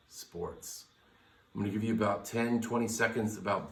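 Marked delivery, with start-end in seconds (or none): 0.1-0.8 playful whisper. Acted. Playful whispered show topic.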